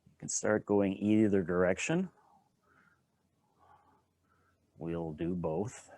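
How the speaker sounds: unhurried, calm guiding voice with long pauses; guided qigong instruction. Acted. Teacher guiding a standing qigong class, calm and unhurried. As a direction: talk the class gently through each movement.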